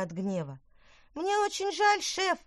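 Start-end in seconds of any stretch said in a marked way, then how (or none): 1.1-2.4 high, choked mumble; strangled voice. Acted. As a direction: mumble it as if being squeezed. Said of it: "сдавленным голосом пробормотал Спаржинзон". (сдавленное бормотание)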